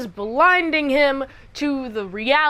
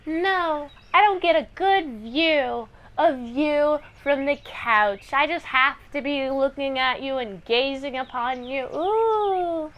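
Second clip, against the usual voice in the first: lightly voiced clingy lover, rising to a cooing ooh; mock-adoring woman who can't stop gazing; swoony, affected refusal; besotted gushing. Besotted woman refuses to leave him.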